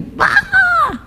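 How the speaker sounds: put-on high, piercing "Para!" as the girl cries out; retold scream. Preacher retelling the crying girl's scream at the helper, a high shriek. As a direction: imitate a desperate girl screaming stop.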